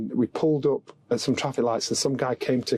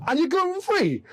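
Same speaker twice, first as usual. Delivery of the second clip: high, disbelieving punchline; comically flabbergasted echo; acted incredulity; 13 semitones higher than usual. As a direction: query it in mock amazement, voice soaring. Retold disbelief, punchline repeated.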